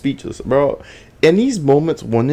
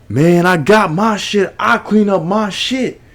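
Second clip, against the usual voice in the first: retold screaming; 6 semitones higher than usual. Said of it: "starts screaming at him".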